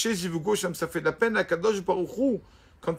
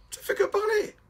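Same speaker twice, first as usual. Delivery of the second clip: very high, mocking voice, far above the speaker's usual range; mocking squeak. Rabbi mocking the student's chatter, very high squeak. Rav mocks the student's praise.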